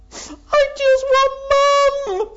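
put-on high, loud, slow wail of a little girl; sobbing child. Little girl wailing to the carer for her mum. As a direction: cry it out like a heartbroken child.

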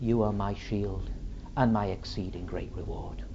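low, soft prayer fading away; hushed devotion. Acted. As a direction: murmur it submissively to a father. Suffering Son answers his Father.

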